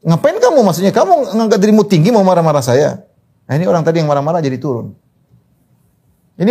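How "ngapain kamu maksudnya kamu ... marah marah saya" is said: retold rebuke. Friend confronting someone who got angry at him, retold indignant rebuke. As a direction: imitate a friend telling someone off. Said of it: "kemudian teman saya tegur".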